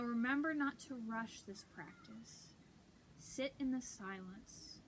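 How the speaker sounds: calm, unhurried meditation voice with long pauses; guided meditation. Acted. Leading viewers through breathing and prayer, guided meditation. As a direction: softly guide them into stillness and prayer.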